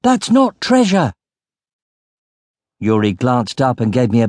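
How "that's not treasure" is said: high, urgent cry; shouting. A narrator's reading. Narrator warns Yuri about the sack, shouting. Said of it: "I shouted".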